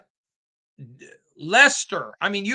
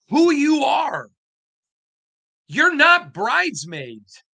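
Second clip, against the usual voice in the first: higher, louder, slowed and emphatic; fired-up rally; 7 semitones higher, 3 dB louder, slower than usual. Real, not acted. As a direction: pump up the fan base. Rallying Raider Nation.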